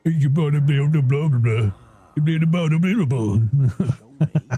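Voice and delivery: hammed-up London menace trailing into giggles; playful British NPC impression; imitating Patches. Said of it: "This guy, this is the real one".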